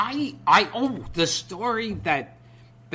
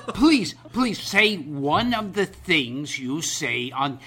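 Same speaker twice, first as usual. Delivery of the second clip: slow, louder begging, word by word; emphatic retold plea; 3 semitones higher, 5 dB louder, slower than usual. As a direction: mimic a fan spelling it out loudly. Retelling fan's louder repeated plea.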